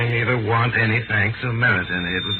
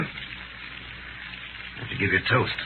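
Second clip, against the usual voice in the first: whisper; 4 semitones lower, 9 dB quieter, much slower than usual. Acted. Confiding taunt over dinner.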